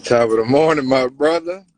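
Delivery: loud, hearty hello; loud greeting. Real, not acted. Guest joining the live to greet host, loud. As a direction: boom it out to your buddy.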